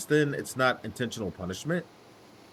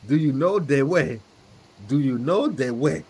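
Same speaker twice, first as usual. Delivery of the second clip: hammed-up meme character voice; squeaky cartoon meme voice; Ugandan Knuckles voice; 3 semitones higher, 4 dB louder than usual. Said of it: "Shout out to, uh, Ugandan Knuckles".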